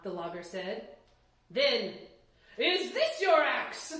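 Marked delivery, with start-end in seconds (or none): 1.5-3.9 loud, slow, probing question; insistent old man. Acted. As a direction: lean on this, drawn-out and loud. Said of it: "the old man asked again".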